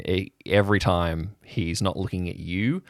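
usual speaking voice

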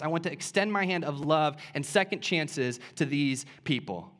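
impassioned preaching, pitched high; full-force preaching. Real, not acted. Preacher telling the church of Jesus's forgiveness, impassioned preaching. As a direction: drive it home to the congregation, high and emphatic.

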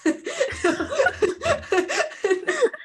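a hint of a grumbling ogre, slowish; lightly voiced ogre, asking again; Shrek impression. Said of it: "do it again".

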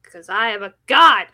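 loud, angry declaration of godhood. Pretend. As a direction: boom it out like an offended god.

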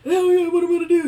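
high, loud comic wail; mock crying. Crying to dad about money, exaggerated high wail. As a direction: wail helplessly, hammed up. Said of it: "like, cry".

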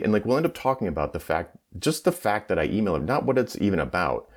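normal talking voice

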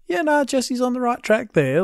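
high put-on voice of an official reply; official Ludo reply. Podcaster voices the show's makers answering a fan, mock official. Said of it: "official correspondence to just go".

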